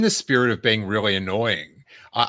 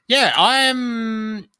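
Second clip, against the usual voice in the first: mock grudging enthusiasm; 5 semitones higher, slower than usual. Real, not acted. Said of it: "I've just got a medium level of exci".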